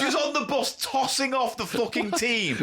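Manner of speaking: laughing crude rant. Real, not acted.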